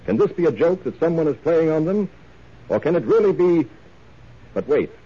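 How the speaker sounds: usual speaking voice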